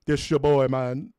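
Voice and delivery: loud Jamaican driver impression; Jamaican accent. Jamaican driver asking dad about his kid, Jamaican accent. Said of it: "just Jamaican dudes".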